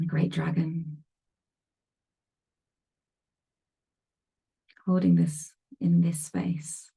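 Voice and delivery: calm meditation guide with long pauses; spacious guided-meditation voice, leaving silence between phrases. Real, not acted. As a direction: ease them into stillness, phrase by phrase. Leads a meditation.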